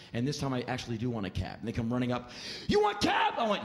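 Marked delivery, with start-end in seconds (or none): 2.7-3.3 touting shout. Retold or imitated. Drivers rush the comic for a fare, high shout. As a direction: yell it across the street to grab a fare.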